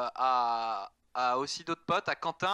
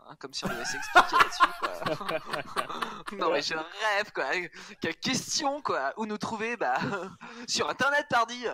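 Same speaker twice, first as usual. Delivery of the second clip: indignation feinte; 4 semitones higher than usual. Pretend.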